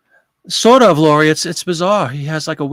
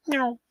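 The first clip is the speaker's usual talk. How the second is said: mock meow; 11 semitones higher, 4 dB quieter than usual. Imitating the cat.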